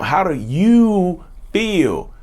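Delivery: drawn-out, emphatic check-in; slow, deliberate question. Real, not acted. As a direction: stretch out every word of the question. Repeats key question very slowly.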